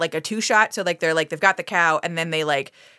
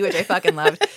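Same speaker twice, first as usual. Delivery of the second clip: ordinary talk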